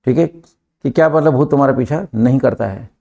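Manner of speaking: normal talking voice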